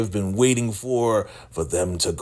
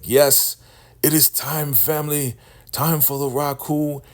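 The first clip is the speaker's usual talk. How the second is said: preaching cult leader; 6 semitones higher than usual. Retold or imitated. Cult leader commanding the wounded woman to rise, booming preacher. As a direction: call down power like a faith healer.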